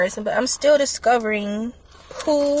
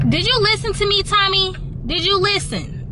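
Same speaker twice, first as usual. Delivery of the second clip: higher, mock-stern scolding of herself; 9 semitones higher than usual. Vlogger scolding herself for tearing up, mock stern. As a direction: tell yourself off like a strict parent.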